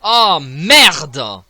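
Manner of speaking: sudden loud swear; shocked loud oh merde; loud curse. Real, not acted. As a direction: blurt it out at the screen. Dies in game.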